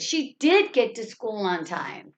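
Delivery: did punched, rest tailing down; emphatic did, falling away to the end; stress on did. Shown as a demonstration. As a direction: insist with a strong did. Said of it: "It's the word did".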